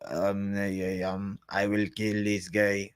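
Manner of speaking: low, slow, soft attempt at a Mongolian accent. Shown as a demonstration. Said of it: "and best Mongolian accent".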